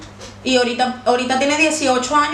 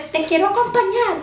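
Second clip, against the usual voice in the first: mock Anna voice; 7 semitones higher than usual. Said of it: "la loca le dice".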